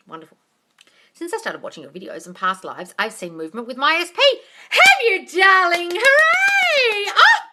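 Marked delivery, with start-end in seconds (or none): 4.7-7.4 very high, loud squeal; thrilled cheer; excited. Real, not acted. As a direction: burst with joy for her. Excited reply to viewer.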